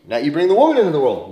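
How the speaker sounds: ordinary delivery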